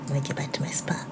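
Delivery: breathy whisper to herself. Real, not acted. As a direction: whisper it as a quick aside. Settling back in, aside.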